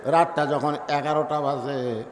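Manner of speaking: ordinary talk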